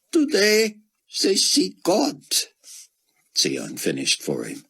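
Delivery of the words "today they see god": much higher, slow, squeezed Israeli voice; a broken scholar clinging to scripture; grieving Israeli scholar. A narrator's reading. Grieving scholar tells Buck his faith, high and squeaked.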